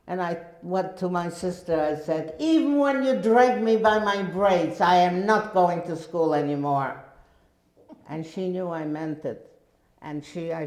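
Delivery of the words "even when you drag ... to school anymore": loud, high vow that won't bend; defiant refusal. Retold or imitated. Girl telling her sister she will never go back, defiant. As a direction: refuse flatly and mean every word.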